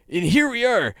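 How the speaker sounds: high, loud, excited announcement; excited shout. Acted. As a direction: sing out the arrival excitedly. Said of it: "she shouts excitedly".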